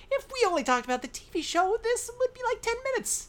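very high put-on protest; mock-exasperated squeal. Co-host answering parents' complaint about the show, mock-exasperated squeal. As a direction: squeak out an indignant retort.

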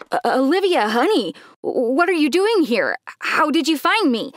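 taken aback, stumbling into anxious questions; shocked. Acted. Dad finds his daughter at the restaurant, shocked.

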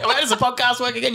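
a hint of a tipsy auntie, high and fast; drunk auntie voice. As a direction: play the tipsy auntie, lightly. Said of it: "You do sound like a drunk auntie".

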